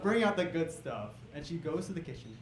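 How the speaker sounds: usual speaking voice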